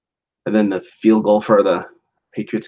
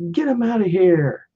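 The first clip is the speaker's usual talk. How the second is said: higher, a touch sharp mock command; lightly voiced haughty host; Martha ordering security; 11 semitones higher than usual. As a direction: play Martha throwing him out. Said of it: "Martha's like".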